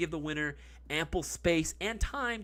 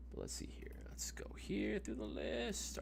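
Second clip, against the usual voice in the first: whisper; 7 semitones higher, 13 dB quieter than usual. Acted. Whispering while scrolling.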